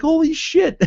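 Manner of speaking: high-pitched retold amazement; astonished. Fan retelling his reaction to the pictures, astonished. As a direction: leap way up in pitch, mock shock.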